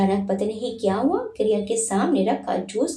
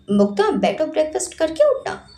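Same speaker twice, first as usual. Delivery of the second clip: commanding; 6 semitones higher than usual. A narrator's reading. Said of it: "पवित्रा जी ने आदेश दिया".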